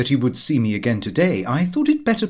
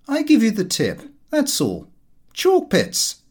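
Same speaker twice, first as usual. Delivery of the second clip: much higher, breezy know-it-all son; cocky young son; 10 semitones higher than usual. Acted. Son airily tipping his father on chalk pits, much higher voice. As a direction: toss off the tip like a smug young swell. Son Lupin repeats the tip.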